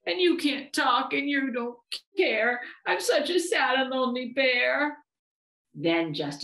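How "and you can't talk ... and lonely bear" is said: high, plaintive bear lament; whimpering. A narrator's reading. Lonely bear cries to his teddy, whimpering. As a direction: pour out the misery in a high whimper. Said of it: "he whimpered".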